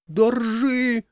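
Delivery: high-pitched alarmed yell; frantic cry. A narrator's reading. Crowd yelling to men holding the bell, frantic. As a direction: cry out in panic to hold on. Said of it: "истошно завопили в толпе".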